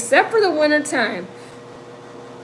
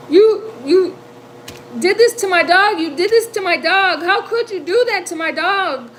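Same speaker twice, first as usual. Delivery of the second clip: high, upset, accusing cry; freaking out; 7 semitones higher than usual. Retold or imitated. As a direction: relive a distraught accusation, pitched up and urgent. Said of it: "I started freaking out".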